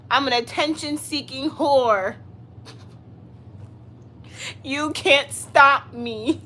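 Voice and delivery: loud, slow and laughing, daring the chat to stop her; defiant laughing boast. Real, not acted. Streamer taunting her live chat, loud laughing defiance.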